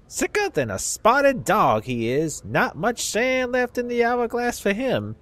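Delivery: put-on southern accent. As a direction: play a crusty old Southern dustman in a Southern accent. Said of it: "give old people southern accents".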